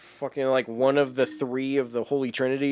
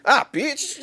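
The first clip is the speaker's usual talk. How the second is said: high squealing cry, much higher; mock Witch King; 13 semitones higher than usual. Retelling the Witch King scene to a friend, high mock yelp. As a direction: Witch King yelping in panic, hammed up. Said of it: "the Witch King just goes".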